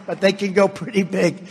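usual speaking voice

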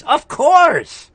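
indignant, high-pitched outburst; really riled and insistent; worked up. Real, not acted. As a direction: rant with your pitch pushed up. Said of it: "I don't wanna get worked up".

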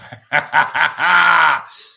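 loud burst of laughter. Real, not acted. Laughing hard.

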